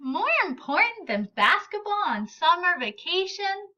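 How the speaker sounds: can't believe he'd skip basketball; incredulous friend. Acted.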